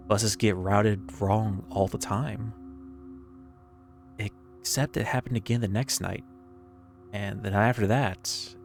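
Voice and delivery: plain reading voice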